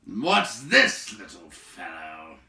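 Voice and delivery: loud, slow, gruff scorn; frowning giant. A narrator's reading. Giant frowning down at the hobbit, loud gruff scorn. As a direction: peer down at the hobbit. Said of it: "he said, stooping down to frown".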